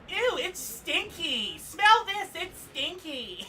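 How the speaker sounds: put-on very high, loud, slow kid shrieking; little boy squeal. Comedian imitating her son at a fire, high loud squeal. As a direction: squeal it like a gross-out six-year-old boy. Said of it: "And then go".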